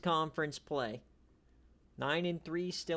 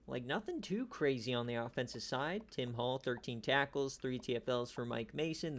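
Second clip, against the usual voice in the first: ordinary talk, nothing marked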